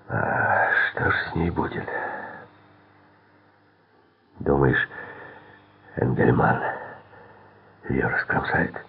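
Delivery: hushed, halting question; breaking off between words, uneasy. A narrator's reading. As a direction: ask it hesitantly, half afraid. Fearful question about her fate.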